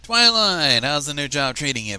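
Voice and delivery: loud, hearty hello; hearty greeting. Acted. Barry greeting Twilight heartily after her speech, loud and hearty. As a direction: greet her like an old pal. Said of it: "Barry asked heartily".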